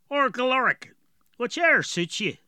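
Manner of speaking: put-on gruff dwarf with a heavy rural accent; strong dwarf accent. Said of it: "Galoric had a very strong accent".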